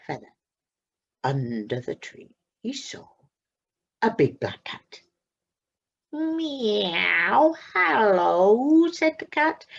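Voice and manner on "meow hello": drawn-out meow into a hello; friendly cat greeting, meowing. Acted. Cat meows in story.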